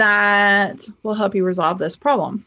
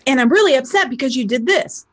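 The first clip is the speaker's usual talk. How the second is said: higher-pitched mock angry complaint; upset, lashing out; 4 semitones higher than usual. Angry person trying again to throw anger, upset outburst. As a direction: burst out with an acted, aggrieved protest. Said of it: "So you take it again. You're like".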